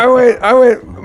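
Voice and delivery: usual speaking voice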